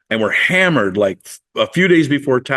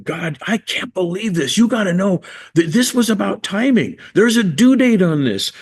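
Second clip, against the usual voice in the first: high, pleading rant at God; indignant complaint to God, high and pressing; retold furious prayer; 9 semitones higher than usual. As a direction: vent the frustration upward, pitch climbing. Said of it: "I'm furious with him".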